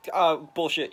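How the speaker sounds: loud, scoffing call-out; imitating a loud scoffing boss; dismissive. Jameson dismisses Ben's refusal.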